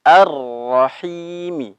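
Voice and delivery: slow Ar-Rahimi with vowel ending; Quranic recitation. Shown as a demonstration. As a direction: stretch out Ar-Rahimi gently. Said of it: "Dan yang terakhir". (bacaan pelan Ar-Rahimi)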